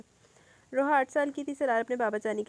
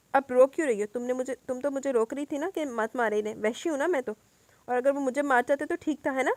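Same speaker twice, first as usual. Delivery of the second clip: restrained anger; 4 semitones higher, 6 dB louder than usual. Acted. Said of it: "غصے کو قابو کرنے کی کوشش".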